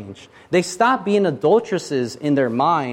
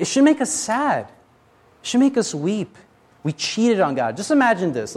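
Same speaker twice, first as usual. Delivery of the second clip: ordinary talk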